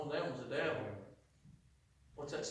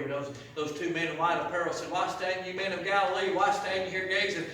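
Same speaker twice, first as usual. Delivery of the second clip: raised, ringing sermon voice; preaching scripture; 6 semitones higher, 9 dB louder than usual. Acted. Preacher proclaiming scripture to the congregation, raised and ringing. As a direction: proclaim the verses to the church.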